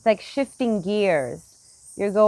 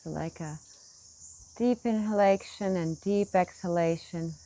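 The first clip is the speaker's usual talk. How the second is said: guided meditation; 4 dB quieter than usual. Acted. Teacher leads meditators through smooth breaths, soft guided meditation. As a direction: lead the breath gently, leaving long pauses.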